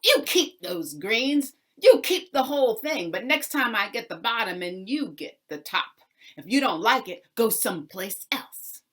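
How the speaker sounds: furious landlord laying down new terms, loud; angry demand. Acted. Angry landlord resets the deal.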